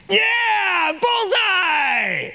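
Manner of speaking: put-on a teen cheering himself on with a shout. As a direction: whoop like a kid who just hit bullseye. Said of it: "cheering himself on".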